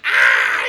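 loud, short mock shriek; mock scream. Retelling the woman hiding in trash, a loud mock shriek. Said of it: "and she's like".

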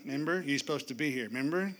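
low, needling reminder; guilt-trip him sweetly; passive-aggressive Martha reminding Jesus. Retold or imitated. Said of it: "Passive-aggressive Martha".

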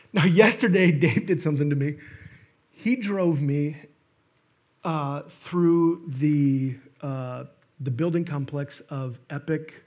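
normal talking voice

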